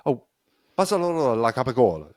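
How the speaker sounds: high mock-Italian flourish; imitated original form. Co-host imitating how the word started, high mock Italian. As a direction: start high and elaborate, full Italian flavor. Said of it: "It's probably starts as".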